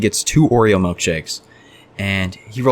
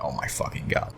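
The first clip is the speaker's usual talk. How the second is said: breathy, much softer groan of despair under the breath; exasperated mutter; 5 semitones lower, 11 dB quieter than usual. Retold or imitated. Fast food worker groans to himself at a late milkshake order, whispered. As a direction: let it slip out as a whispered groan.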